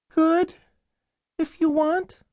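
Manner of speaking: put-on very high, small child's voice; tinsel-voiced little boy. Starving boy answering caseworker, tinsel voice. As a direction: answer in a thin tinsel treble.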